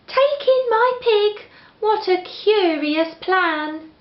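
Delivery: put-on high, pleading little old lady; a fussing old lady asking for help. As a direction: beg the wise man in a high, fretful voice.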